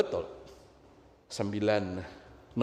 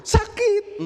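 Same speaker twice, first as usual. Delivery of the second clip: high, loud mock labour cry; tiruan kesakitan; 7 dB louder than usual. Mimics talk during labour. (seruan sakit tinggi dan keras)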